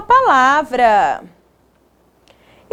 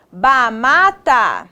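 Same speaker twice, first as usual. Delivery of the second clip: "Bamata" slow, each syllable separate; sounding out; much slower than usual. Shown as a demonstration. Teacher testing made-up word.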